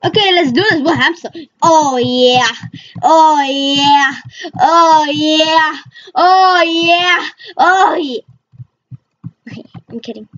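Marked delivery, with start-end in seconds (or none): 1.6-8.2 mock cheer building up. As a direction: chant it, climbing in pitch. Repeated cheer, climbing pitch.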